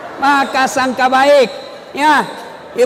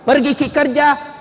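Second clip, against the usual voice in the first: ordinary talk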